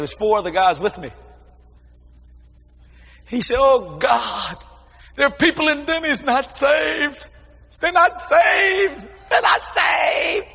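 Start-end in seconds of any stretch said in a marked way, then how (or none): 3.6-10.5 high, slow, wailing plea; a pleading wail, much higher and slow; crying out. Retold or imitated. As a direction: retell the pastor's tearful outcry. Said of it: "He didn't care if he, he cried".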